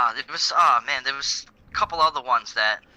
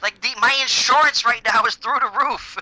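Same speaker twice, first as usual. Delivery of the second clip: complaining mock voice; 6 semitones higher than usual. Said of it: "It's like".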